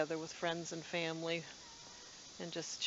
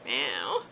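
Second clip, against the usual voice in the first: low, loud rising meow; a deep mock meow that turns up at the end; imitated cat meow; 7 semitones lower, 5 dB louder than usual. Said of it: "That's all she does".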